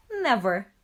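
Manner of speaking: mother wolf reassuring her leaving son; fervent reassurance. A narrator's reading. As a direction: promise with all your heart.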